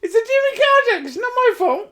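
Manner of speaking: very high, loud mock innocence; squeaky defence. Real, not acted. Defending the joke in a squeaky high voice.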